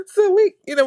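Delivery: high, breaking up with laughter; can barely get it out for laughing; laughing to tears. Real, not acted. Telling the group the funny story, laughing to tears.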